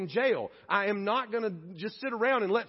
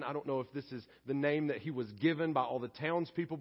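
ordinary talk, nothing marked

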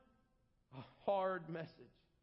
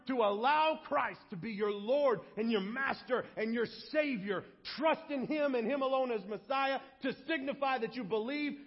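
loud, higher, commanding preacher; forceful call to repent, driving and raised; Peter; 4 semitones higher, 4 dB louder than usual. Acted. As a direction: exhort the crowd at full force, emphatically.

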